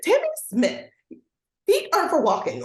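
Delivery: put-on high teacher correcting a child; scolding full-name emphasis. Shown as a demonstration. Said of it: "say the first and last name for that emphasis".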